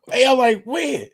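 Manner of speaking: high, loud incredulous yelp. Retold or imitated. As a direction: squeak it out in total disbelief. Retelling own disbelief.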